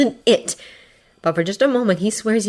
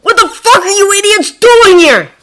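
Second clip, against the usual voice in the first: high, loud strained outburst; furious, squeezed-up shout; furious whisper-shout; 14 semitones higher, 11 dB louder than usual. A narrator's reading. Bakugo confronts classmates behind cover, furious whisper-shout. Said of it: "whisper shouting with muffled fury".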